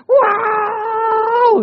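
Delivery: high loud mock sob-cry; mock crying yelp. Preacher showing how a man cries, mock crying yelp.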